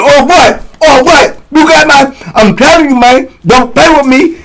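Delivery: high, sputtering mock outrage; heated confrontation. Inmate squaring up to old head, mock-angry.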